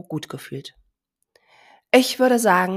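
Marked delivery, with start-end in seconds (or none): 1.9-2.8 loud call to the herd; loud announcement. Acted. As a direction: announce it to everyone. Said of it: "verkündete seine Mama laut".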